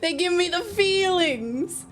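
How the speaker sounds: slow, high cutesy voice; uwu voice. Pretend. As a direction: coo it like an uwu girl. Playful put-on voice.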